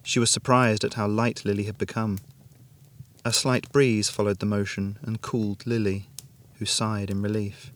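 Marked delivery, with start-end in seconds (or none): none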